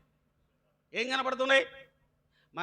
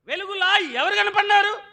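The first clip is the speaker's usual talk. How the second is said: raised, ringing sermon voice; preaching; 12 semitones higher, 11 dB louder than usual. Acted. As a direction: call it out to the congregation. Preaching question.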